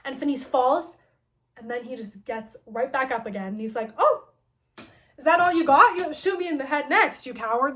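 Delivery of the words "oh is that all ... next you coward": high, loud mock-villain taunt; hammed-up scornful dare. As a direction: goad the shooter, loud and pitched up. Villain taunts after being shot.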